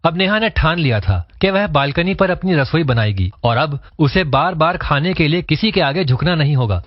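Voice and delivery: ordinary delivery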